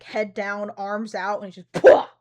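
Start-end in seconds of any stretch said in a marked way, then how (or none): 1.7-2.1 loud sudden pwah; lunge impact noise. Pretend. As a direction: burst out a tackle noise. Imitating Freddy lunging.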